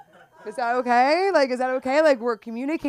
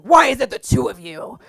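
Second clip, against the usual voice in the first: inner dad voice; 3 semitones higher, 11 dB louder than usual. Retold or imitated. Comic imitating her inner dad at the doctor. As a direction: boom it out like a fed-up dad. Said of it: "my inner just dad came out in me".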